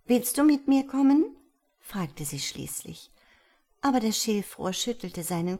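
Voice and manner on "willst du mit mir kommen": high, louder eager question; hopeful swallow. A narrator's reading. Swallow finally asks the reed to come along, eager. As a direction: ask it hoping for yes.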